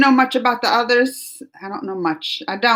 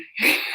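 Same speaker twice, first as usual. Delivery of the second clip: quick burst of laughter; real laugh; 4 dB quieter than usual. Real, not acted. Streamer reading viewers' calm-down comments, amused laugh. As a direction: laugh at yourself for getting carried away.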